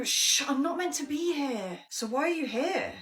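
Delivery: ordinary delivery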